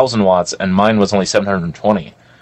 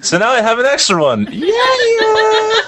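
high, rising celebratory whoop; excited high-pitched cheer; gleeful boast; slower than usual. Real, not acted. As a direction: crow over an accidental windfall. Gleeful boast about stolen speaker.

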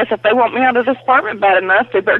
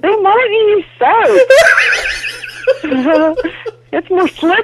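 laughing shout; 11 semitones higher than usual. Real, not acted. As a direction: yell it through laughter. Laughing, shouting at her sister.

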